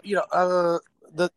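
usual speaking voice

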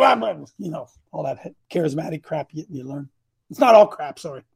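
ordinary talk

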